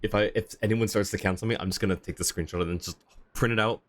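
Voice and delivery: ordinary delivery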